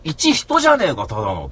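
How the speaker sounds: high, loud scornful shout; mock furious outburst; retold angry yell. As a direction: yell it with contempt. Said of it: "つって言ってさ".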